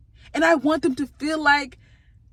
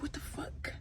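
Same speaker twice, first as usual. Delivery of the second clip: whispered, baffled disbelief; hushed, voiceless aside; incredulous whisper; 18 dB quieter than usual. Retold or imitated. Incredulous reaction to coworker.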